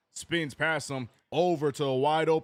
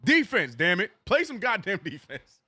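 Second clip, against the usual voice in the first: louder, higher, pleading for defense; exasperated yell; 6 semitones higher, 6 dB louder than usual. Real, not acted. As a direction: shout it at the TV. Fan yelling at his team.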